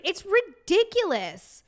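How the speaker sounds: slow, high, fed-up "It's ridiculous"; drawn-out exasperation. Real, not acted. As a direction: drag the word out in disbelief.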